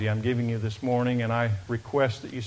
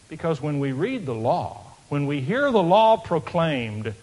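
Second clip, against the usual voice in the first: ordinary talk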